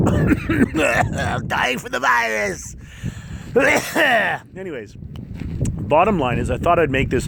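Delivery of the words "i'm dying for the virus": slow high raspy invalid; sick coughing voice. Pretend. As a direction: cough through it, hammy sick.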